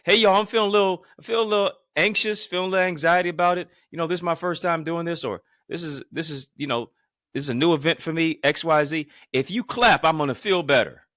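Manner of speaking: high, loud, fast pumped-up plea; rally the room to clap for you; hyped appeal to the crowd. Shown as a demonstration. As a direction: turn your nerves into crowd energy, loud and high. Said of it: "Now, do you see that?".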